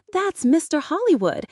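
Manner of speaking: high, loud accusing scream; a frantic shout across the room. A narrator's reading. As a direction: point him out at the top of your voice. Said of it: "he screamed".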